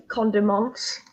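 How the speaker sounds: condiments said as a mock-French word; French accent. Streamer listing snacks to friends, French accent on condiments. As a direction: put a French pronunciation on condiments. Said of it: "say it in a French accent".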